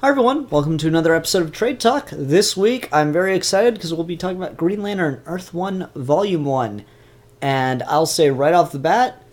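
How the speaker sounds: bright, high host intro; show intro. Acted. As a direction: welcome viewers like a presenter.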